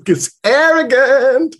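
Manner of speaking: high, slow mock arrogance; mock arrogant. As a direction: puff yourself up, hammed-up. Joking self-mocking aside.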